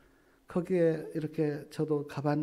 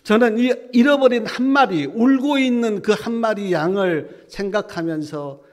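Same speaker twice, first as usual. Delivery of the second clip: much louder, higher preaching cry; impassioned preaching; 5 semitones higher, 11 dB louder than usual. Real, not acted. Preacher crying out to the congregation about the lost sheep. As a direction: preach it out with full force.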